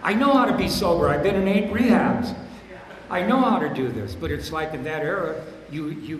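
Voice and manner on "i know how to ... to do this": louder and higher, know-it-all mockery; laughing, loud mock of a smug newcomer. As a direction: ham up the cocky newcomer who knows it all. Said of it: "newcomers union where they say".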